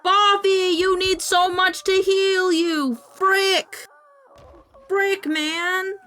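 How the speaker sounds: high exasperated whine. Real, not acted. Dismayed at healing cost.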